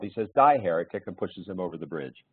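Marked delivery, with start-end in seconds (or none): 0.3-1.1 a sudden loud cry of "Die, heretic"; shouted punchline. Acted. Joke teller shouting the Baptist's final line, loud. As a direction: bark it out like a zealot.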